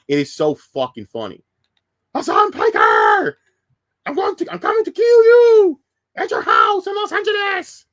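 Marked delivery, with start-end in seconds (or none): 2.1-7.9 screamed fake threat, much louder and way higher; Sam Hyde death threat shout. Streamer replays Sam Hyde's joke threat as a shout. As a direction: bellow it like an over-the-top boxing promo villain. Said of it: "It's Sam Hyde".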